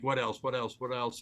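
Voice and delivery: quick, low repeated questions with no gaps; rapid-fire. Retold or imitated. Skeptic mimicking a barrage of follow-up questions, rapid-fire. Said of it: "not in that rapid fashion".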